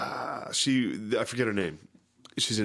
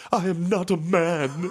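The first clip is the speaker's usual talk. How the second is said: much higher, slow, laughing; outraged and pitched up; indignant high voice; 10 semitones higher, 4 dB louder than usual. Retold or imitated. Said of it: "he was like".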